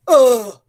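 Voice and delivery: a loud grunt as a fist hits the belly; winded oomph, pushed out hard; punched grunt. Acted. Farmer punched in the stomach by a monkey, grunting. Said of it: "Ziti went".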